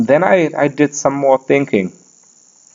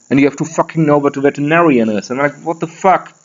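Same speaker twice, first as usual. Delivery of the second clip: ordinary talk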